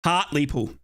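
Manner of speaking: "Hartlepool" as HART-lee-pool; corrected pronunciation. Shown as a demonstration. Commentator drilling the town name after apologising, "Hartlepool" as HART-lee-pool. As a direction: repeat "Hartlepool" carefully, clear LEE in the middle. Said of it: "I apologise".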